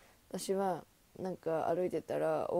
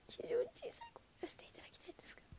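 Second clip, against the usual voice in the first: fast, soft whisper; 15 dB quieter, much faster than usual. Real, not acted. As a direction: whisper it like a secret. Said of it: "非常に大きい声で言えないんですけども". (ささやき声で早口に)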